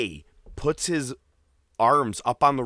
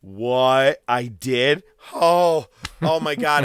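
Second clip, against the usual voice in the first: stunned disbelief; 3 semitones higher, 5 dB louder, slower than usual. Retold or imitated. Retold shock at being covered.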